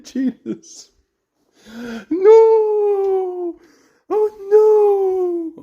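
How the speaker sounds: high, anguished wailing no; panicked cry. Real, not acted. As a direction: cry out in horror as it breaks. Figure breaks, panicked reaction.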